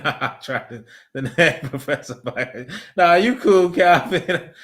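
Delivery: ordinary talk, nothing marked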